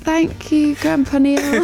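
high-pitched, humouring thank-you; mock polite thanks. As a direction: play up a meek reply to an elder. Acting her sheepish reply.

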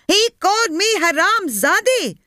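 high, outraged cry to the women. A narrator's reading. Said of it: "she repeated".

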